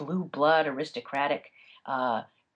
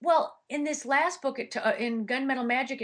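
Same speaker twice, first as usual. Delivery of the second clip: ordinary talk, nothing marked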